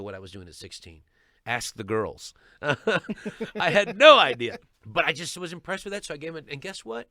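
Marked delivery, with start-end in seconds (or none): 2.6-4.6 "no idea" loud, high and drawn out; loud, slow stress on "no idea"; emphatic repeat. Real, not acted. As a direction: belt out "no idea" slowly for emphasis. Emphatic repeat for comic effect.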